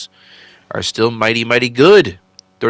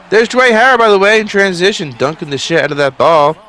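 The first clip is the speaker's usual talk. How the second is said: hyped-up play call, higher and louder; excited; 9 semitones higher, 6 dB louder than usual. Real, not acted. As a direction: shout the dunk call with real excitement. Big transition dunk call.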